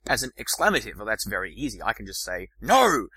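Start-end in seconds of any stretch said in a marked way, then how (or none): 2.6-3.1 loud, high no; loud exclamation; exclaimed no. Shown as a demonstration. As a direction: let the word burst out. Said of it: "That's clearly an exclamation".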